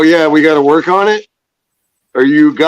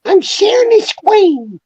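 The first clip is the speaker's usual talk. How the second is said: mocking voice. Echoes the line in a put-on voice.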